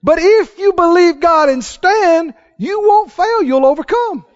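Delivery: loud, high preacher's cry, drawn out; full-force preaching. Acted. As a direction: preach it hard to the crowd.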